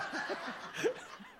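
a high burst of real laughter. Real, not acted. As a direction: laugh at yourself, brief and high. Laughs at own bitterness.

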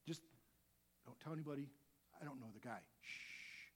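whispered shushing, soft; hushed denial. Acted. Peter quietly shushing the accusing crowd, whispered. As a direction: hush them, keep it secret. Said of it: "His first nice, quiet look".

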